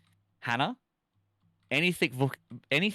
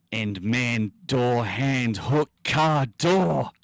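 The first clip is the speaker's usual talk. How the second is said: rising higher and louder word by word; building climax; 3 semitones higher, 10 dB louder, much slower than usual. Acted. Streamer hits the scrambled punchline, climbing higher and louder.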